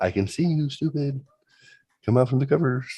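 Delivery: put-on high, teasing kid voice; mock taunt, pitched high. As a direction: tease him out from under the covers. Taunting hidden brother.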